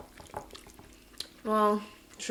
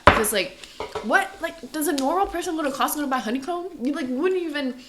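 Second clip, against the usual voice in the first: excited; 5 semitones higher, 5 dB louder than usual. Real, not acted. Excited honeycomb story.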